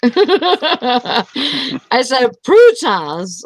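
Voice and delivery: correcting the mishearing by leaning hard on prutas; stressed correction. Shown as a demonstration. To a friend who heard croutons, stressing prutas. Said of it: "Not croutons".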